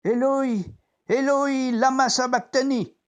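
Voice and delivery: high, loud, despairing call; anguished cry. Retold or imitated. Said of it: "et le Nazaréen a crié".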